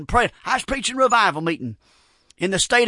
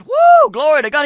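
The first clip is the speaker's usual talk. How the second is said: louder, higher joyful cry; shouting praise; 5 semitones higher, 6 dB louder than usual. Retold or imitated. Granny rejoices that God supplied her need, loud happy shout. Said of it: "she really went to shouting".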